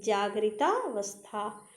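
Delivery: जागृतावस्था slowly, with a long 'taa'; joined compound. Shown as a demonstration. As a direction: draw out the join in जागृतावस्था. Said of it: "ध्यान दे". (जागृतावस्था धीरे, 'ता' लंबा)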